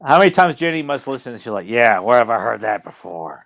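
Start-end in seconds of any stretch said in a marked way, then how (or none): 1.7-3.5 low, slow, husky woman's voice; deep raspy woman. Retold or imitated. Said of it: "she doesn't sound like that".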